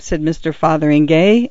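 ordinary delivery, nothing marked

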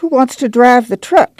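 high, needling grandma; spiteful. A narrator's reading. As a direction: toss it out with mild spite. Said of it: "she said spitefully".

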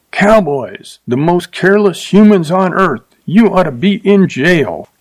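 a restrained scream, pitched well up. A narrator's reading. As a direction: cry out in outrage without full volume. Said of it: "looked at him and screamed".

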